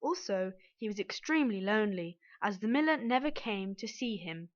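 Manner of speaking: plain reading voice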